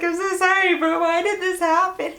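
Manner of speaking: high-pitched, retold tearful wail; mock crying. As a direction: act out a sobbing apology, playfully exaggerated. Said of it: "like, crying".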